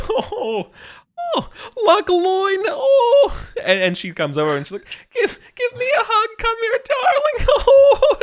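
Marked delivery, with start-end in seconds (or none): 0.1-3.5 high, cooing society lady; Lady Cosmonauta. Acted. Lady kissing cheeks in greeting, high theatrical cooing. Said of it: "It's Lady Cosmonauta". 5.1-8.2 very high, overwrought greeting; gushing, mock crying. Lady demanding a hug from her darling, high mock-tearful gush.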